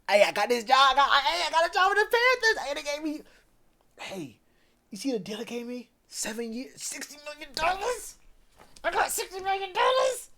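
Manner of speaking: ex-teammate boasting about his Panthers deal, giddy and high; hyped-up buddy. Retold or imitated. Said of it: "He's like".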